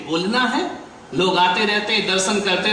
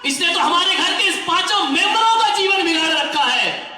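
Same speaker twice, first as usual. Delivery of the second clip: angry scolding; 10 semitones higher than usual. Acted. Mother-in-law yelling at her returned daughter-in-law, furious high shout.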